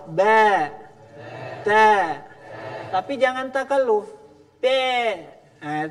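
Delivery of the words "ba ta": Ba and Ta said loud with the voice aimed down; thin ba and ta. Shown as a demonstration. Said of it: "suaranya diarahkan ... ke bawah".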